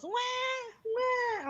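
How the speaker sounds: high mock "wah" cry; imitated wail. Mom mimicking the wail that woke her, high mock cry. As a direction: imitate a little one wailing. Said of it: "she goes".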